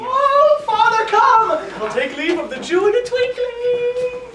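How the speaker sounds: high, whooping call, vowels stretched out; gleeful exulting. Acted. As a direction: crow with delight to your father. Servant exults at new post.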